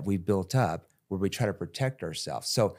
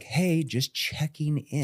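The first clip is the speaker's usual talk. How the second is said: slow, drawn-out mock sales voice, higher; cringey salesperson; 7 semitones higher, much slower than usual. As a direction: stretch out the fake-friendly check-in. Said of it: "Ugh, cringe".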